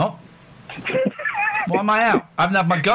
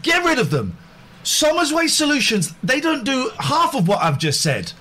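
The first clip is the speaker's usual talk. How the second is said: high, pushy ad rant; hard sell; 6 semitones higher than usual. Acted. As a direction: oversell it like a pitchman. Over-the-top sponsor rant.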